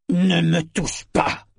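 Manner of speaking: furious father's slow shout to his son; enraged. A narrator's reading. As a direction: bark it slowly, full of rage. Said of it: "lança Kyle d'une voix rageuse".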